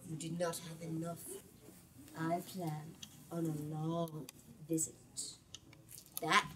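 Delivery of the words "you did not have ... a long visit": low threat. Acted. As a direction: linger on the threat quietly. The Jumbi threatening the witch.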